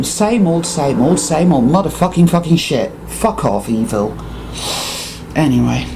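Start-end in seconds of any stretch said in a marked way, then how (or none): none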